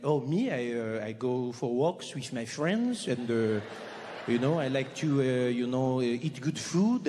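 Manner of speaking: a Frenchman explaining his leisurely life, mock French accent. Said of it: "You go up to a French person".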